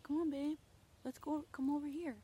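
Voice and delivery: high, gentle sing-song coaxing; sweet coaxing call. Shown as a demonstration. Owner showing the kind way to call her dog, high and sweet. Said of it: "But if I'm like".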